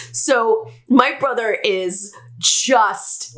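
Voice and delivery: ordinary talk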